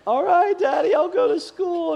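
put-on very high, slow, whimpering boy; crying child. Son gives in crying, very high and small. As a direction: squeak it out like a defeated sobbing kid. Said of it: "He's crying".

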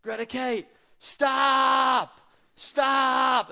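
put-on high, loud child's shout at his sister; little brother yelling. Boy in the car yelling at his crying baby sister, high and loud. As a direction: yell it like a fed-up little brother. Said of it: "we can't talk to her that way".

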